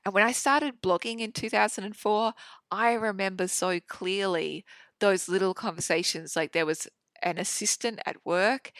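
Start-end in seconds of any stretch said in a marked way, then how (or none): none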